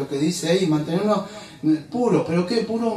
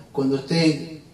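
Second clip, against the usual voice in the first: ordinary delivery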